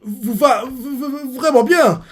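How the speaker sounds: high, nervous stutter on every start; shy soldier stammering a compliment to his lieutenant. Retold or imitated. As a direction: trip over the first sounds, flustered and starstruck. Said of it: "ils commencent à ba-, bégayer".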